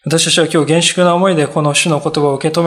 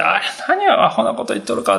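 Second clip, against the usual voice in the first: scoffing; 3 semitones higher, 4 dB quieter than usual. Retold or imitated. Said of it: "もう嘲笑ったって書いてありますよ".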